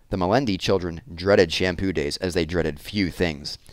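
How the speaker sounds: plain reading voice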